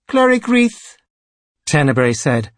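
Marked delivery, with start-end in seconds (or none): 0.1-1.0 high-pitched, commanding priest voice; imperious high priest. A narrator's reading. High Priest greeting his cleric, imperious. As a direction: address a subordinate as his imperious superior.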